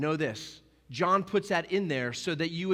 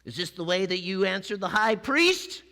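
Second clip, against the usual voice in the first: high, loud haughty official; snooty official; 6 semitones higher, 5 dB louder than usual. Retold or imitated. Temple officer rebuking Jesus after the slap, high and loud. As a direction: sneer it like a self-important servant. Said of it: "that's what he sounded like".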